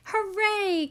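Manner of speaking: high, loud joyful cheer. A narrator's reading. Cookie Monster celebrates with friends, joyful cheer. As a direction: cheer like the plate is finally full. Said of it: "Cookie cried".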